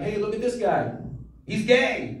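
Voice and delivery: mock heckler jeer; heckler taunt. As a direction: jab like a heckler. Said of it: "they're like".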